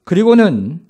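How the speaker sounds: ordinary delivery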